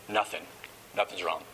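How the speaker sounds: low, clipped sulking answer; sulky boyfriend. Retold or imitated. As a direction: play the hurt guy brushing her off, low. Upset boyfriend answers his girlfriend.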